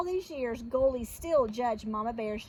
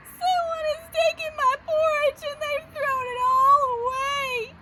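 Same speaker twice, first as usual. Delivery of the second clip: high, loud tearful wail; Baby Bear; 12 semitones higher, 6 dB louder than usual. Acted. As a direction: cry it out in a wee cub voice. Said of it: "cried in his wee little voice".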